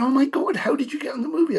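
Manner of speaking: high and fast, astonished questioning; amazed. Retold or imitated. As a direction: fire the question out in disbelief. Colleagues amazed he got cast.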